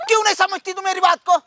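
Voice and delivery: fast, high-pitched outburst; impatient, rapid scolding; exasperated demand. Acted. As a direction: fire it off in frustration. Exasperated that she keeps refusing.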